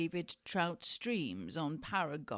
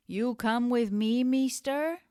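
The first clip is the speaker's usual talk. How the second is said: put-on loud, high Mexican-accented question; mock Mexican accent; 5 semitones higher, 9 dB louder than usual. Hustler inviting a stranger along, loud mock Mexican accent. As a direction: call out pitched up in a Mexican accent.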